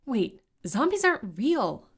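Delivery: loud, high-pitched disbelief; emphatic startled objection; exclaiming. A narrator's reading. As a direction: protest in alarm. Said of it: "exclaimed Serena".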